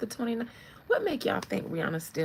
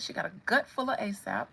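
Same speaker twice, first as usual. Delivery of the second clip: ordinary talk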